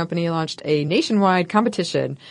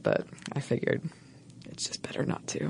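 whispered aside; 3 semitones lower, 12 dB quieter than usual. Real, not acted. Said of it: "I thought about doing this in an Australian accent".

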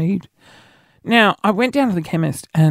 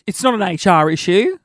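usual speaking voice

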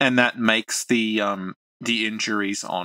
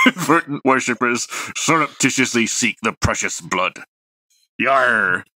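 louder hammed-up pirate reading, breaking into laughs; 5 dB louder than usual. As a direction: read it like a pirate captain to his crew. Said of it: "your best pirate voice".

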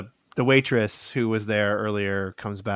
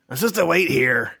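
higher, louder dwarf insisting; insistent dwarf; 5 semitones higher, 5 dB louder than usual. Acted. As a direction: press the point, louder. Dwarf repeats, more insistent.

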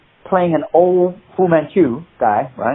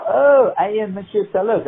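mock-grand French introduction, pitched up; French chauffeur impression; 7 semitones higher than usual. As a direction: reveal yourself in an old Frenchman's voice. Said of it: "He was doing the impression of his chauffeur".

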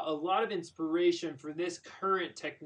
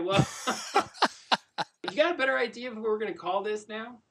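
ordinary talk, nothing marked

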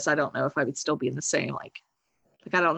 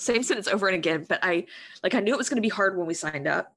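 usual speaking voice

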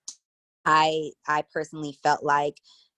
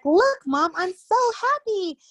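put-on very high, squeaky, bubbly little girl; cartoon toddler voice; 3 dB louder, slower than usual. Toddler showing mom she's happy, very high squeaky voice. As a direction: squeal it like a cartoon kid full of joy. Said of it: "she talks like a character".

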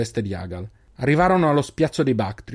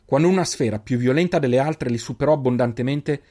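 ordinary delivery, nothing marked

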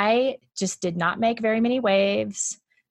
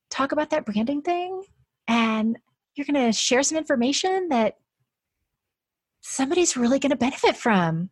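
put-on talking to a child; 6 semitones higher than usual. Shown as a demonstration. Speaker voicing how to comfort a small child, sweet and higher. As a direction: coax her like a little kid, ending brighter. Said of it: "how would you speak to a five-year-old".

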